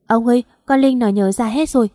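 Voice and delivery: plain reading voice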